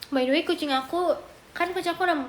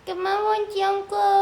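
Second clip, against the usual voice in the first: cat's voice; 6 semitones higher, 6 dB louder than usual. Pretend. Voicing the cat's refusal.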